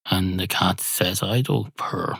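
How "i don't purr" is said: soft, understated cat's refusal; muted denial. Acted. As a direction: state it softly and plainly.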